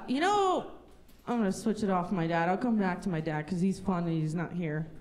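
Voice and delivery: usual speaking voice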